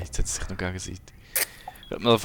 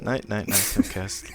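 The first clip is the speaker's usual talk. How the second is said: ordinary talk, nothing marked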